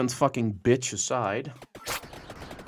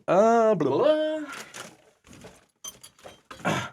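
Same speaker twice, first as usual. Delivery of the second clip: mocking babble; 8 semitones higher, slower than usual.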